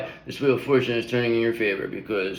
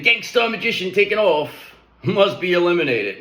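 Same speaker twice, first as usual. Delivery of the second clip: hyped-up; 6 semitones higher than usual. Real, not acted. Hyping the gangsta magician.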